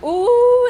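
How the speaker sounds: loud, high, drawn-out "ooh"; high cheering "oooh" of delight; excited "ooh". Acted. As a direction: whoop like a thrilled audience. Spirit team cheering as they watch.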